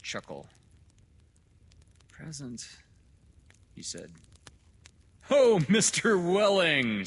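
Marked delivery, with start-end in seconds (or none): 2.1-2.7 small, high, wretched voice. A narrator's reading. Welling answering the doctor, wretched. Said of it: "gave a hoarsely wretched chuckle". 5.3-7.1 loud, high, drawn-out greeting; hearty doctor. Acted. Doctor hailing the father he overlooked, booming and hearty.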